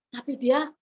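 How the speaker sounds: ordinary delivery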